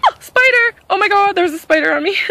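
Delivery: high, fast panicked shriek; real fright, squealing fast; screaming at a spider. Real, not acted. Spider lands on her.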